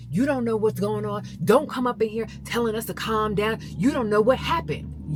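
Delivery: imitating coaches yelling, high and very loud; angry yelling. Coaches turning on a player's father, angry yelling. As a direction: tear into him at full volume, high-pitched. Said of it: "yelling at my husband".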